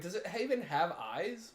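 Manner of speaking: usual speaking voice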